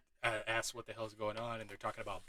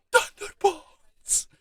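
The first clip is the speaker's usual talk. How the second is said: long, very high drawn-out shout; team cheer; 12 dB louder, much slower than usual. Retold or imitated. Kids' soccer team cheering its name, long high shout. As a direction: stretch the cheer out at full voice.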